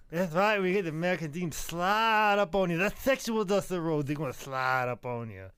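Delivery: high-pitched lisping wrestler promo; hammed-up wrestler impression; Dusty Rhodes. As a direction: play a swaggering Southern wrestler with a lisp. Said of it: "do a Dusty Rhodes impersonation".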